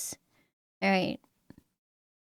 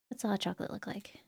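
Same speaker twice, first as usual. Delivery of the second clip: whispering; 3 semitones lower, 14 dB quieter, faster than usual. Real, not acted. Quick aside to self.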